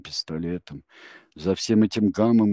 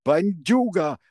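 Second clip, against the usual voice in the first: higher and louder angry rebuke; furious woman shouting; yelling; 10 semitones higher, 8 dB louder than usual. A narrator's reading. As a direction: bawl him out for swearing. Selma keeps yelling at Tsvirik.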